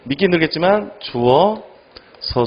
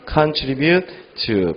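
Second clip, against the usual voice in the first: slow word reading; much slower than usual. Shown as a demonstration. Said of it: "기여하다".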